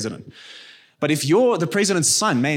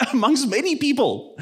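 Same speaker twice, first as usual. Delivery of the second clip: laughing through the words; amused, the laugh spilling in; 9 semitones higher than usual. Real, not acted. Pastor laughing about the lion king's fearsome reputation. Laughs describing scary king.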